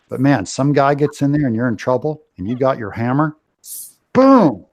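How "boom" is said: loud, high-pitched "boom"; booming impact. Acted. Host acts out a hammer blow, loud booming impact. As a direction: punch it out like a hammer landing.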